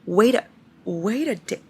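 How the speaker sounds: ordinary talk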